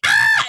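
high laughing shriek. Retold or imitated. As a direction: yelp up high in alarm. Reaction to Richard.

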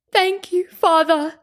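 high-pitched cry of thanks. A narrator's reading. Mother thanks the gods for her son, high cry. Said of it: "cried my mother".

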